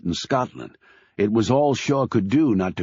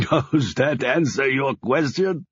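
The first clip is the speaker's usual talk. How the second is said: slow, laughing boom of a big man; father laughs, welcoming his daughter's suitor; 4 semitones higher than usual. A narrator's reading. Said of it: "Laughing, he boomed".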